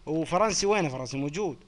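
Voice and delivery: ordinary talk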